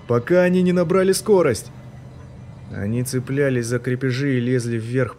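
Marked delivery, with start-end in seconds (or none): 0.1-1.7 high, loud urgent yell; shout. A narrator's reading. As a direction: scream the warning. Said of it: "кричал Полнов".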